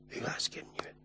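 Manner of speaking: a hushed, unvoiced whisper; whispered as an intimate aside. Real, not acted. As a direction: drop to a whisper to make it sink in. Pointed closing to the man.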